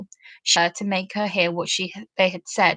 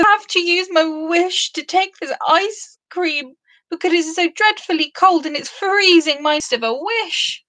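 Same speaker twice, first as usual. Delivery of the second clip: higher, pleading sniffly voice; miserable pixie; 8 semitones higher than usual. Acted. Tag complaining of the freezing ice cream, whiny. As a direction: whine it out, half-sneezing.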